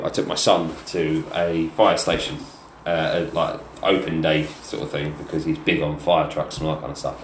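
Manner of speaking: ordinary talk, nothing marked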